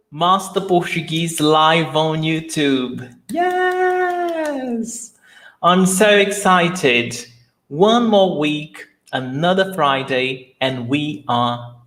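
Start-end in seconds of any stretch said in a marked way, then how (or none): none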